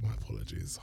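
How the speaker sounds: whispered apology; sheepish little whisper. Real, not acted. Apology right after the honking.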